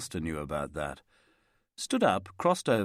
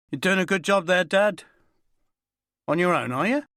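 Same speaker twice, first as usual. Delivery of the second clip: friendly call; 10 semitones higher, 6 dB louder than usual. A narrator's reading. Terry hailing the window cleaner.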